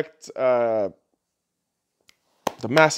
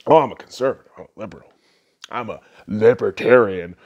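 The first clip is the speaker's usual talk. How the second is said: sneering mock of people stating their party; mocking political labels; 10 semitones lower, faster than usual. Vlogger mocking people who announce their ideology, sneering mimic. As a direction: say each label like a dumb boast. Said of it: "when you tell me what your political ideology is".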